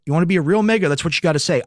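ordinary talk

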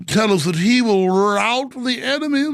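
lightly put-on royal British; mock Prince Charles accent. Host introduces Gorka with a lightly mocking royal British accent. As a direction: say it like a pompous royal. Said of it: "sound like Prince Charles".

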